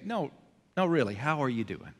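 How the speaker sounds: a quiet, caring second ask. Retold or imitated. Friend asking a hurting friend how they really are, sincerely. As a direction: lean in and really mean it. Friend who truly wants to know.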